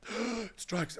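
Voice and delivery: high, alarmed exclaim; shocked realisation. Retold or imitated. Panicking at the party, high alarmed blurt. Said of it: "I was like".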